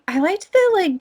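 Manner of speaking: normal talking voice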